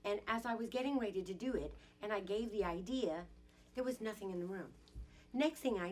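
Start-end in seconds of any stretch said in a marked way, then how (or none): none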